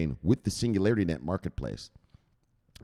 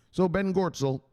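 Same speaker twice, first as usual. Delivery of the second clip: excited; 10 semitones higher than usual. Real, not acted. Hyping the CEO's name.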